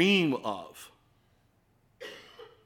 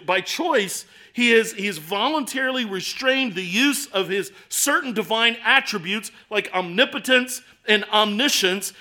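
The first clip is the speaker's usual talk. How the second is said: louder, raised sermon voice; preaching at full force; 7 semitones higher, 8 dB louder than usual. Acted. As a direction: press the point home to the congregation. Long exhortation about Christ's choice.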